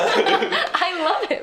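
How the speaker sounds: slow, laughing through the words; delighted giggle while talking; real laughter over the line. Real, not acted. Laughing at the YouTuber bit.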